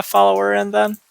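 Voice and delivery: usual speaking voice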